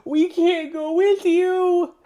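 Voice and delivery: high, pleading little voices; pleading book characters. A narrator's reading. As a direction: beg the reader not to go.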